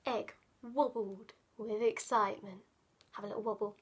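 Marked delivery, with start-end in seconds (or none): none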